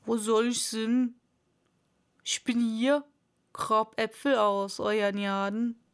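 dialect-speaking farmhand voice; servant Pat. A narrator's reading. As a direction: answer your master in a rustic dialect. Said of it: "eine Stimme, die sie noch nicht gehört".

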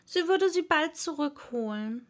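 ordinary delivery

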